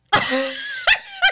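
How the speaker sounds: high shrieking burst of laughter. Real, not acted.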